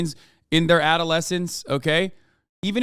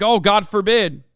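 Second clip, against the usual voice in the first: mock horror; 5 dB louder than usual. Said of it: "where you're like".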